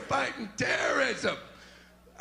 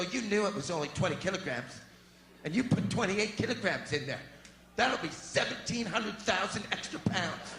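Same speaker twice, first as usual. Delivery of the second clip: lightly voiced scolding clerk; 5 semitones lower, 4 dB quieter than usual. Check-in clerk scolding passenger.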